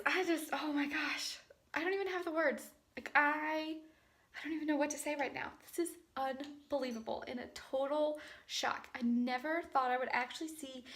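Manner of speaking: quiet, stunned, breathless disbelief; barely above a whisper, overwhelmed; soft trembling excitement; hushed shock. Real, not acted. Recorded clip right after test.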